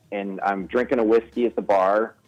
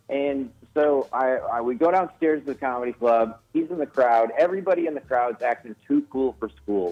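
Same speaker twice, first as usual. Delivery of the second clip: ordinary talk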